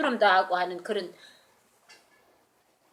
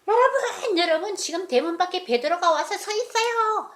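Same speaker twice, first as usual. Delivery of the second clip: much higher, laughing with joy; overjoyed announcing; 9 semitones higher than usual. Acted. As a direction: burst in with happy news. Rhoda runs in overjoyed. (기뻐서 웃으며 외치는 목소리)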